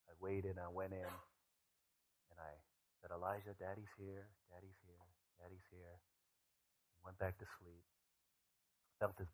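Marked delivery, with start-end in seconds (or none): 3.1-6.0 soft, fading comforting murmur; father hushing his feverish son, very soft; hushed soothing. Retold or imitated. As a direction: soothe a crying child back to sleep. Father calming his crying son.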